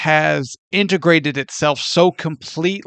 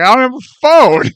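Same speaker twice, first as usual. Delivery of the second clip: mock buddy voice; 8 semitones higher, 5 dB louder than usual. Quoting the buddy, high and loud.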